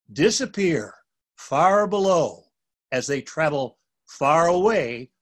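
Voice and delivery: ordinary delivery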